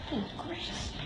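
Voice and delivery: breathy whispered exclamation. Real, not acted. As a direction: whisper it under your breath. Overwhelmed by cats.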